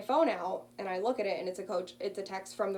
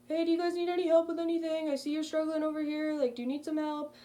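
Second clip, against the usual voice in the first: higher, faster, friendly helper voice; security guard calling from his car, friendly and higher; 8 semitones higher, 4 dB louder than usual. Retold or imitated. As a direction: play the kind security guard offering help. Guard offers help, voiced.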